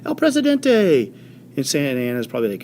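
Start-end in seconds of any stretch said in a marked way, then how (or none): 0.0-1.1 high, slow, drawn-out calling out; yelling. Retold or imitated. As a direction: point him out, shouting across the camp. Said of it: "starts yelling".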